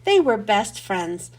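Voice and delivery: ordinary delivery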